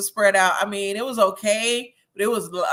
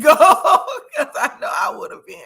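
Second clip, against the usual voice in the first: loud, high, laughing through the words; real laughter; 9 semitones higher, 8 dB louder than usual. Real, not acted. Host joking with viewer about herself, laughing. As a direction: break up laughing as you speak. Joking she'd have been one too.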